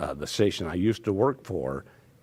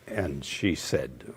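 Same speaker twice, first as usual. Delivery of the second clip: ordinary talk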